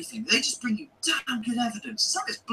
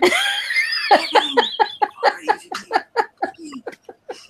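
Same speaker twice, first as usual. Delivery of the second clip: high, loud mocking laugh; long loud mocking laugh; 13 semitones higher, 6 dB louder than usual. Real, not acted. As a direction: let out a big scornful laugh. Laughs at his own jab.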